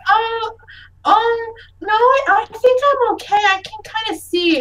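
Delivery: put-on high, hesitant little-kid voice; child character. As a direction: stammer it as a child putting on a brave front. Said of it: "She's like".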